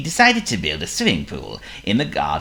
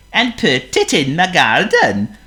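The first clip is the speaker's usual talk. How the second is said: Honk the seal; 11 semitones higher, 7 dB louder than usual. Acted. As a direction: play a polite silly seal. Honk's voice.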